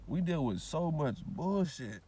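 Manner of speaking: very slow, every word weighted; slow heavy emphasis; slow emphatic. Real, not acted. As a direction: drag it out to drive it home. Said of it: "repeated for emphasis".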